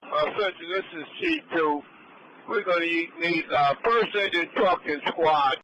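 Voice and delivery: loud, urgent radio call; urgent firefighter radio. Real, not acted. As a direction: call for backup over the noise. Chief calls for more units.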